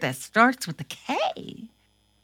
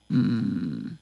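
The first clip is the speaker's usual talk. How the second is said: ordinary delivery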